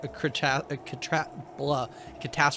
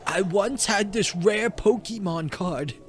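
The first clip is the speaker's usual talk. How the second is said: slow, eerie creepypasta narrator; spooky storyteller voice; 6 semitones higher than usual. Acted. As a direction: tell it like a ghost story by candlelight.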